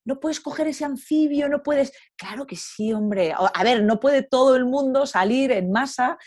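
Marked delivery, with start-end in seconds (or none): 0.0-1.9 high, mocking whiny voice; mocking impression of people who object to touching wildlife; whiny naysayer. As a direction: parody the fussy objector. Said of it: "la gente que dice".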